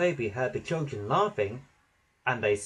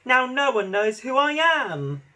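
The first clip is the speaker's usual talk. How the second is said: high, loud wail of self-pity; moaning; 11 semitones higher, 5 dB louder than usual. A narrator's reading. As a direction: whine it out, wounded. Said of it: "moaned James".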